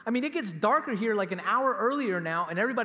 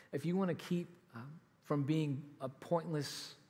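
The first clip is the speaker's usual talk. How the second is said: lower, softer earnest exhortation; quiet sincere preaching; 7 semitones lower, 5 dB quieter than usual. Acted. Preacher to the congregation, quiet and sincere. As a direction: draw them in, quietly sincere.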